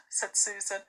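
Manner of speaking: ordinary delivery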